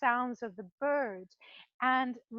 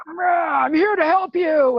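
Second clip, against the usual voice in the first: loud pushy visitor; 3 semitones higher, 12 dB louder than usual. Retold or imitated. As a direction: boom out "I'm here to help you" like someone after a bad day. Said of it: "they've had a really bad day and they're loud".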